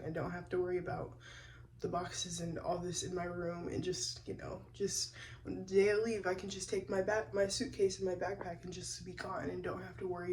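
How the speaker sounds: keeping voice down early morning; hushed, careful murmur; hushed talk. Real, not acted.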